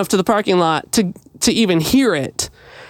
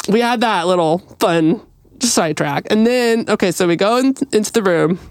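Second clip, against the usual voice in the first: normal talking voice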